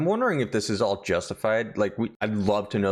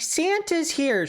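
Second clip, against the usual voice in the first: put-on much higher, slow, sing-song little girl; slower than usual. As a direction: draw it out like an excited small child. Little girl insisting again.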